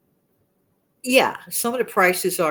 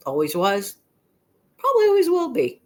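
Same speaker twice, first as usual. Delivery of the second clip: normal talking voice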